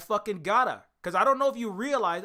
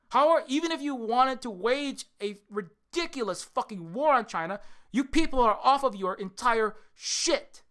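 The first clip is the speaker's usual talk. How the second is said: loud, higher, slowing at the end; loud rant; 4 semitones higher, 3 dB louder than usual. Real, not acted. Streamer ranting at chat, loud. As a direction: rant hard, almost shouting. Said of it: "say it as loud as possible".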